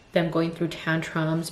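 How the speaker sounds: normal talking voice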